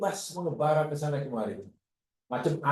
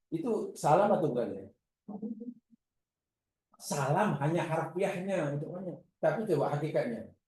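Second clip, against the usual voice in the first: normal talking voice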